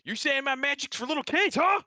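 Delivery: shout from the back. Acted. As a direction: call out offended from across the room.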